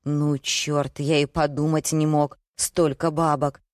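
usual speaking voice

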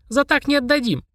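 put-on high, decided little girl; firm refusal in a child voice; resolute. A narrator's reading. Girl bargaining over the book, high and resolute. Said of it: "Полина решительно заявила".

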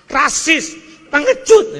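sudden high loud outburst; mock harsh insults in a sermon. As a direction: spit out the insults. Preacher mocks hateful speech.

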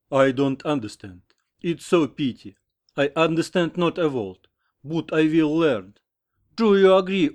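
earnest, regretful English with a Russian slip; passionate foreign suitor. A narrator's reading. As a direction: plead like a lovestruck American. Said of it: "заговорил с сожалением и страстью". (страстная речь иностранца с сожалением)

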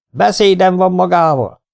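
loud, high demand; bossy farmer summoning the vet; commanding. A narrator's reading. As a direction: order him to talk. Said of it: "szólt parancsoló hangom".

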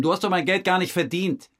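loud, high, contemptuous; scornful rebuke. Retold or imitated. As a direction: put the tramp in his place. Retold rebuke to the tramp.

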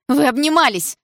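high, loud outburst; jealous accusation. A narrator's reading. Jealous girl accusing Дима of hugging, outraged. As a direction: cry out in hurt jealousy.

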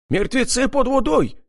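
high, urgent alarmed shout. A narrator's reading. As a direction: warn the others, raising the alarm. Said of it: "закричал Аким".

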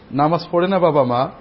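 ordinary delivery

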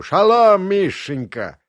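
loud, high "Шалом" bellowed into the phone; Изя grabs the phone and yells a greeting, loud; yelling. Acted. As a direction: roar the greeting down the line. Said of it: "и заорал".

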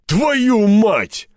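high, loud startled curse; too-loud exclamation. A narrator's reading. Степаныч spots the climbing rope and exclaims too loudly. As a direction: blurt it out far too loudly. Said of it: "воскликнул Степаныч. Вышло слишком громко".

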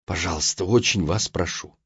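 low, hushed plea in a retold quote; почти шёпот. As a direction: imitate someone pleading almost in a whisper. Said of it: "почти шёпотом добавил". (тихая просьба почти шёпотом)

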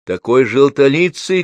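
high, loud, drawn-out call; shouted back. A narrator's reading. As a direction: call it out slowly across the room. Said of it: "крикнула тётя Груша". (громкий протяжный выкрик)

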